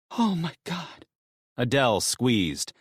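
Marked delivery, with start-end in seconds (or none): 0.1-1.1 high, softer woman's voice; hushed overwhelmed wife. Acted. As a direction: breathe it out, overcome. Wife's voice voiced by narrator.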